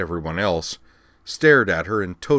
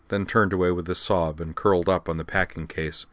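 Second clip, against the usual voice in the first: plain reading voice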